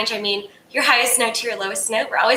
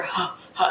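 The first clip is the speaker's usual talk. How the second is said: low, short, primal grunts; soft, deep guttural "huh"; guttural gorilla huffs; 4 semitones lower, 6 dB quieter than usual. Shown as a demonstration. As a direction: huff low from the chest like a gorilla. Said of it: "channel my inner gorilla".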